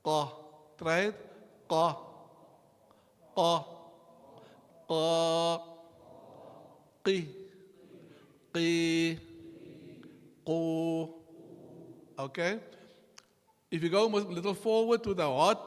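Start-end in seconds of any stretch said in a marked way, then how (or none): 0.1-11.1 "Qa" made with the back of the tongue on the soft palate; deep qaf. Shown as a demonstration. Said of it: "the qaf is from the soft palate".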